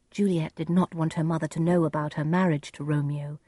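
plain reading voice

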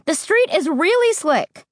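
anxious mother, voice pitched high; shrill warning. A narrator's reading. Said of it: "Mom warned shrilly".

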